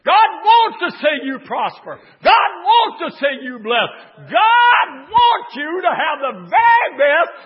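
loud, high preacher's cry; full-throated revival preaching; preaching at full force. Acted. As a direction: build the congregation up, shouting.

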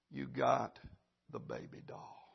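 low, soft, fading almost to nothing; acted shock, the last words barely voiced; stunned hush. Stunned mother reveals the doll.

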